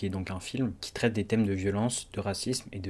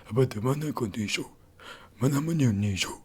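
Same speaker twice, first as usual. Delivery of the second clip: mock the rapper's strained delivery; put-on imitation of his usual rap tic; forced rapper voice; 2 semitones higher than usual. Reviewer caricatures the rapper's interpretation, forced voice. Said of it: "C'est tout le temps".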